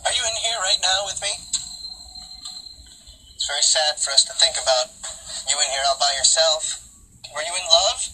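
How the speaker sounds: talking to a ghost. Real, not acted. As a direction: gently coax the spirit out. Calling out to a dead girl.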